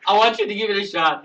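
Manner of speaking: high, insistent pleading; urgent coaxing. Real, not acted. Host begging a shy caller to try, urgent coaxing. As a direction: urge loudly, pitched well up.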